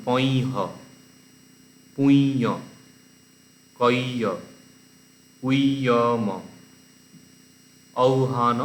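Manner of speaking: পই হ, পুই, কই, উইয়ম, ঔহান read one by one with long pauses; careful list reading, পুই and কই each set apart; pronunciation demo. Shown as a demonstration. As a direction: model each form from পই হ to ঔহান separately, vowels drawn out. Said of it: "আমি তাও বলে দিচ্ছি".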